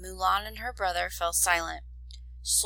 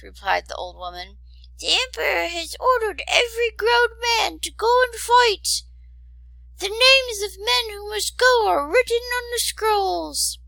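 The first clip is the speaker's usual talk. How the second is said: put-on much higher, tearful old woman; 5 dB louder than usual. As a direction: lament the war as a crying old woman.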